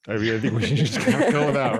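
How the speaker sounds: amused laughing aside. Real, not acted. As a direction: let a laugh run through it. Jokes about copying the French.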